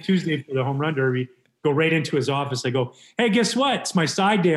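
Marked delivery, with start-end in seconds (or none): 3.2-4.5 brash announcement. Retold or imitated. Bursts into manager's office to announce.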